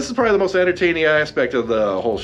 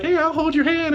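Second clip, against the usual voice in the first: put-on cheerful girl voice. Voicing fearless Shuri.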